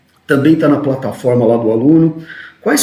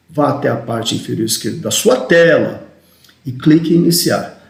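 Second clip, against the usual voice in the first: "sua tela" raised and punched; stress on SUA TELA. Shown as a demonstration. Trainer models screen directions for a user, stress on "sua tela". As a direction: keep the start even, then hit "sua tela". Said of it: "a gente usa a inflexão na palavra correta".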